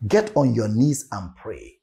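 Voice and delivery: usual speaking voice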